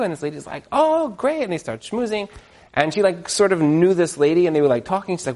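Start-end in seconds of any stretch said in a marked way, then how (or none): 0.7-1.4 very high, sweet "Oh, great"; gushing new wife. Retold or imitated. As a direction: squeal it out like a happy newlywed. Other lady answers cheerfully.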